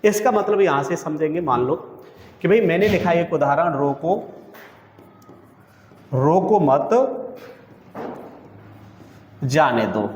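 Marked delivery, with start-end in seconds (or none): 3.7-10.1 halting, broken delivery with long silences after रोको and मत; pauses between words. Shown as a demonstration. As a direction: say रोको मत जाने दो in separate pieces, leaving the meaning open. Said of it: "अब इससे आप क्या समझ पा रहे हैं". (शब्दों के बीच लंबे विराम)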